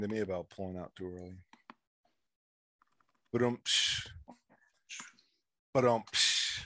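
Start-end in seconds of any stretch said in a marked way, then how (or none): 3.3-4.1 imitated drum sting with a cymbal splash; mouthed rimshot. Host caps his own pulling-out joke, mock rimshot. 5.7-6.6 imitated drum sting with a cymbal splash; mouthed rimshot. Host caps his own pulling-out joke, mock rimshot.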